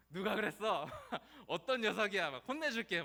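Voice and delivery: loud, high mock outrage; hammed-up protective dad, laughing between phrases; indignant father. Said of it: "아버지는". (아버지가 호통치듯)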